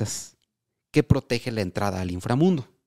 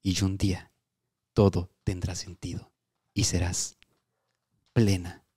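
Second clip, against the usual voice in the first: soft tender message; 4 semitones lower, 4 dB quieter than usual. Pretend. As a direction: soothe her like an oracle.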